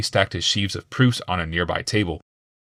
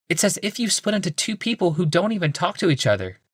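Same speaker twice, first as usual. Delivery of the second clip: much higher woman's voice; a polite wife, lifted and high; Rebecca; 11 semitones higher than usual. A narrator's reading. Wife greeting her husband, high woman's voice.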